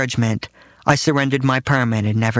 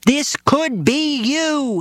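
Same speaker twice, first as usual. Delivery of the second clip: very high, slow warning father voice; father warning son about New York; ominous father; 14 semitones higher, much slower than usual. Retold or imitated. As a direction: drag it out as a high doom-laden father. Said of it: "he wrote in the margins".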